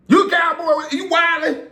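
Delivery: high, loud shouting fan; excited fan shout. Retold or imitated. Fan shouting at the player he recognizes, high and loud. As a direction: yell it like a stunned fan spotting him.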